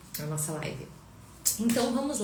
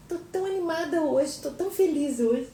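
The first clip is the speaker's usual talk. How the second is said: put-on much higher, cute baby voice speaking as the daughter; voicing the baby; 10 semitones higher than usual. Mother speaking as baby Sofia to the viewers, high cute voice.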